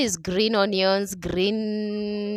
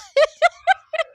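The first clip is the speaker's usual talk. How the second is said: high, loud real laugh; burst of laughter; 6 dB louder than usual. Real, not acted. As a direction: crack up at the word. Laughs at her English word.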